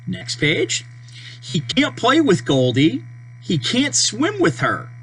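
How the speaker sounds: ordinary delivery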